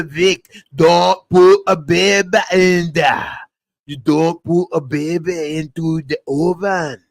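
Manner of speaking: loud, hammed-up character impression; Fat Bastard. Comedian as a movie character warns Vic about the oven, mock bellow. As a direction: stretch the vowels in a big put-on bellow, easing off at the end. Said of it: "Turn it into fat bastard now".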